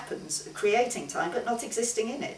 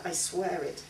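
usual speaking voice

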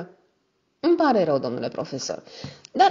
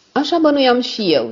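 a harsh, cutting retort, higher and louder; harsh reply; 8 semitones higher, 6 dB louder than usual. A narrator's reading. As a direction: bite the words off sternly. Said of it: "zise profesorul cu asprime".

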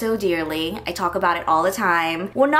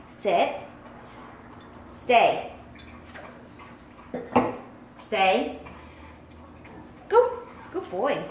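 firm dog commands; 7 dB quieter, much slower than usual. Real, not acted. Second try training dogs.

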